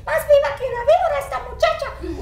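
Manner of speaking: high fast grandmother voice in Spanish; grandma's saying. Retold or imitated. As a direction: channel the Mexican grandma, high and quick. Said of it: "She say".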